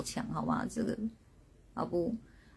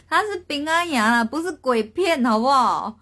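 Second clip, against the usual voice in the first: loud, high exasperated protest; exasperated correction, pitched up and loud; playful exasperation; 5 semitones higher, 7 dB louder than usual. Real, not acted. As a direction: push back loudly at their silly guesses. Corrects viewers' horror-film guesses.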